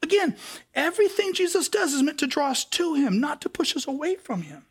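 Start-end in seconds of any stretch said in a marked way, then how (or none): none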